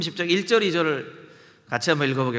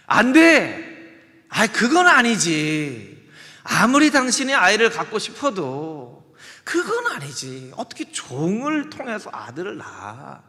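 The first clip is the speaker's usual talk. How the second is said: drawn-out loud no, then slower protest; firm refusal; 3 semitones higher, 7 dB louder, slower than usual. Acted. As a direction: put your foot down. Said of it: "했어야죠".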